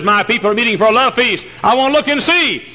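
high, solemn divine voice; a preacher voicing God from heaven. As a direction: speak as God seeking his people. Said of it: "God in heaven looks down tonight and says".

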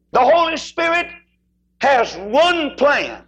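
loud, high, then slowed right down; preaching at full force. Acted. Preacher proclaiming to the congregation, full force. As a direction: proclaim it from the pulpit.

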